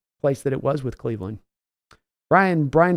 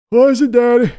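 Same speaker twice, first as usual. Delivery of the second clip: high, loud put-on son's voice; Steven answering; 9 semitones higher, 10 dB louder than usual. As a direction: answer Daddy like a cowed grown son. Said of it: "yelling at Steven".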